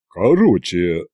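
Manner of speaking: low, halting bass; flustered Иван. A narrator's reading. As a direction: lose the thread in a deep voice. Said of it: "окончательно сбившись". (сбивчивый бас)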